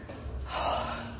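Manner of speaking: heavy winded exhale from the stairs; breathless sigh. Real, not acted. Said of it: "I'm out of breath".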